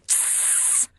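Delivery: sharp voiceless "tss"; fierce hiss. A narrator's reading. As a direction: hiss fiercely to silence someone. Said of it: "Natasha's fierce hiss".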